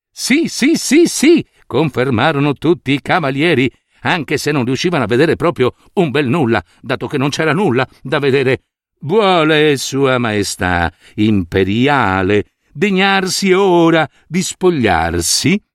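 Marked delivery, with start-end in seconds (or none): 0.1-1.5 eager yeses. Acted. Knights agree eagerly. 9.0-15.6 unctuous courtesy. Acted. Swindlers' unctuous request.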